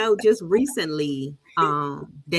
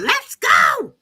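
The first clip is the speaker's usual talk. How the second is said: very high, loud holler; screaming; 5 dB louder than usual. Acted. Mother yelling at her son to leave the game, screaming. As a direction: scream it across the field, high-pitched. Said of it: "I'd be up screaming".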